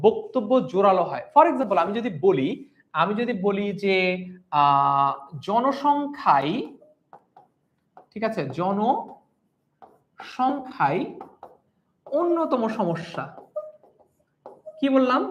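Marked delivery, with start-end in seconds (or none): 5.5-13.2 deliberate emphasis on জনসংখ্যাই with long pauses; emphatic stress. Shown as a demonstration. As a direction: stretch জনসংখ্যাই slowly, leaning on its final ই. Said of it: "একটু জোর দিলাম না".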